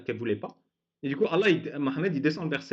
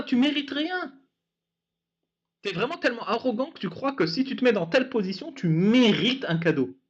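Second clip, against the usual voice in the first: ordinary talk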